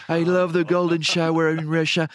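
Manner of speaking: Donald Trump. Retold or imitated. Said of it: "il était là".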